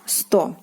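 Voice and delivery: сто said as "sto" with a short clear o; whispered model word сто, "sto"; Russian сто. Shown as a demonstration. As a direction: say сто as one crisp syllable, "sto". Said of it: "one hundred in Russian is, uh, sto".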